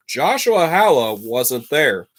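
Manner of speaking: usual speaking voice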